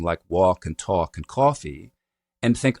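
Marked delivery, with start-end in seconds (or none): 0.3-1.9 "walk and talk and coffee" slowly, rounded "aw"; slow Boston "aw" in "walk and talk and coffee"; little rounding. Shown as a demonstration. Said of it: "the ones that have a little rounding".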